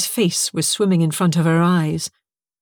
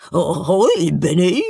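cartoon turkey gobble in words; silly gobbling bird voice, rising at the end; slower than usual. A narrator's reading. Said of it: "it gobbled".

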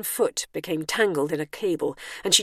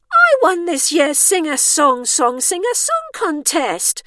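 high, loud, drawn-out boast; disbelieving protest; 11 semitones higher, 8 dB louder, slower than usual. A narrator's reading. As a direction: protest slowly and loudly as an affronted starlet. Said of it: "protested the woman in disbelief".

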